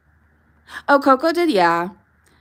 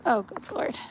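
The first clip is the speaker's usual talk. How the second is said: ordinary delivery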